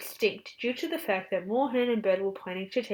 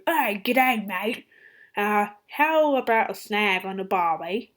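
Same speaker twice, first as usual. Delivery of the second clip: mock Australian accent; 5 dB louder than usual. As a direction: put on a hammed-up Australian twang. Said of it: "have these weird country accents".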